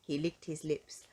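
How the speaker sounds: plain reading voice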